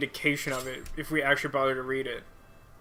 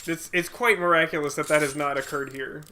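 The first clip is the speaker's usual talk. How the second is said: ordinary talk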